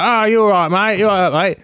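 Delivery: caricatured cabbie checking you're all right; taxi driver impression. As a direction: imitate a matey taxi driver. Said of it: "Does he really talk like that?".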